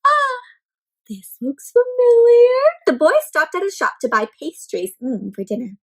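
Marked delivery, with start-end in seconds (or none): none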